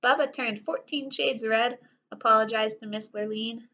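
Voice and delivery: ordinary delivery, nothing marked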